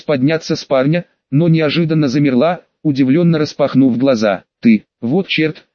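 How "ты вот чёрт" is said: plain reading voice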